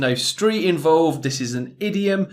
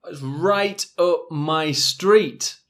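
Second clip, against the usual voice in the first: slow model phrase; slower than usual. Shown as a demonstration. Teacher models idiom slowly.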